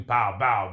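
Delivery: loud vocal gunshot bursts; imitated pistol shots. Storyteller acts out the crew shooting, imitated gunfire. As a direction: punch out pistol bangs.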